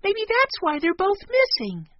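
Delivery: put-on high, loud child's voice; excited child. A narrator's reading. Child guessing why they're both missing, excited. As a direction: pipe up with a sudden idea.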